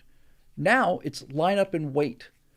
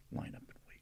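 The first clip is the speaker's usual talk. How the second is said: hushed whisper of contempt; 19 dB quieter than usual. Real, not acted. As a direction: breathe it out with scorn. Whispered disgust at phrase.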